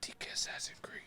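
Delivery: unvoiced whisper, barely audible; whispered aside. Real, not acted. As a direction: slip it in as a secret whispered aside. Side comment muttered under breath.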